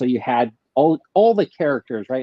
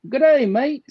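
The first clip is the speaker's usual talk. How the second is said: high, hammed-up Australian accent; broad mock Australian g'day; 13 semitones higher, 3 dB louder than usual. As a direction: play the jolly Aussie greeting it up big. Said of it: "I wanna hear your best Australian g'day".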